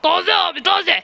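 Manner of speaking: slow singsong hawking; street vendor call. Pretend. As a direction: call out like a market seller.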